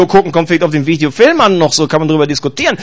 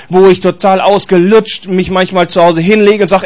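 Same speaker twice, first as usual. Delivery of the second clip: ordinary delivery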